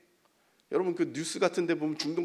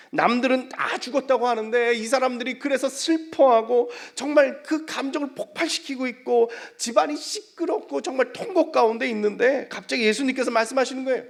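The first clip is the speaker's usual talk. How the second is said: loud, high, urgent sermon; full-force preaching; 12 semitones higher, 12 dB louder than usual. Real, not acted. Preacher contrasts the mourners with Jesus, full-force preaching. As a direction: pile up the clauses with intensity.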